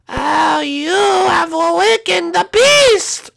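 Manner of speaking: slow, high, drawn-out creature wail; awakened beast. Pretend. Beast announcing its awakening to intruders, high and slow. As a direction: stretch each word into an ominous cartoon cry.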